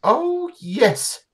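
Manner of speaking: lady's voice, pitched well up; fussy, welcoming old lady; high old woman voice. Acted. GM voices Jamar again.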